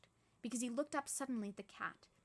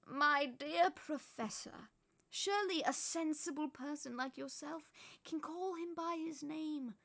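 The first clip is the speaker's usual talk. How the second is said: put-on patient old wizard; 5 semitones higher, 4 dB louder than usual. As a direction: coax her gently but firmly. Dumbledore persuades.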